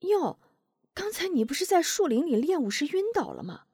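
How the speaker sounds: put-on high surprised old neighbor woman; wide-eyed astonishment in a high voice. A narrator's reading. Neighbor aunt surprised at Wu Zhong, high astonished voice. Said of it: "吃惊地睁大眼睛说道".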